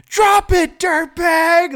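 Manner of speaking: high, full-volume mock command; imitated shrieking order; really loud yell. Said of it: "Like, really loudly".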